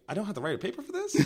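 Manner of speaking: mock disbelief. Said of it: "I was like".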